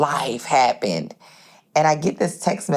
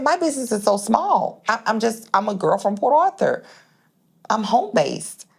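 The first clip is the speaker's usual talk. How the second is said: disbelief; 6 semitones higher than usual. Retold or imitated. Protesting in disbelief.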